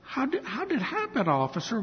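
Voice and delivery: high-pitched, halting grieving father; acted tearful plea. Crying father asks officer how it happened, acted tearful plea. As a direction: ask haltingly through mock tears. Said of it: "he's crying and crying".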